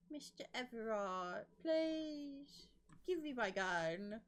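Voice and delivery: high, drawn-out playful begging; whiny pretend plea; mock pleading. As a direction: beg pitifully, hammed-up. Mock begging a game character.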